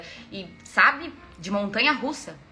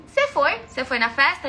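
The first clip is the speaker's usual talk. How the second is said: higher, louder probing question; insistent questioning; 4 semitones higher, 4 dB louder than usual. Retold or imitated. Woman questioning her boyfriend on the phone, insistent. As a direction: press for an answer on the phone.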